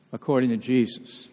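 usual speaking voice

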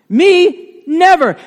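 loud high refusal; emphatic denial. Retold or imitated. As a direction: insist hard on it. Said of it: "Very emphatic".

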